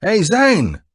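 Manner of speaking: loud, high hail across a lot; yelling. A narrator's reading. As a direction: shout his name from afar. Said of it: "he yelled".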